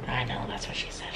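joking aside in a whisper. Real, not acted. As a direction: breathe it out under your breath. Joke muttered under her breath.

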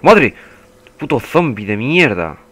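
loud, worked-up insults; angry cursing. Real, not acted. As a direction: curse at the enemy mid-fight. Curses the zombie while fighting.

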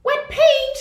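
put-on high, bright-eyed little girl voice; little girl Ruby. A narrator's reading. Ruby explains to Angela, in a high little-girl voice. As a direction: squeak it out like a small girl. Ruby's voice in story.

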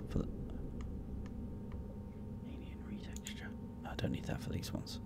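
whispered under breath; whispering. Real, not acted. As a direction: mutter it under your breath. Muttering to himself.

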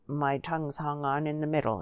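ordinary delivery

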